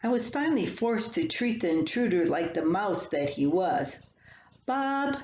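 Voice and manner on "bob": calling out. Retold or imitated. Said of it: "I called".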